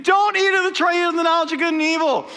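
high-pitched, animated retold decree; God's command. God warning Adam and Eve about the tree, animated command. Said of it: "God says to Adam and Eve".

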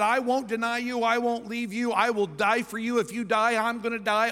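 high, insistent vows piling up; bold pledges. Acted. Peter's bold pledges, acted.

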